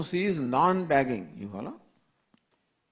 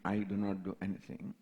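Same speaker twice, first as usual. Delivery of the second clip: low, quiet and settled; soft calm conclusion; 6 semitones lower, 8 dB quieter, faster than usual. Retold or imitated. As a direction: let it fade out calmly and softly. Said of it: "he says".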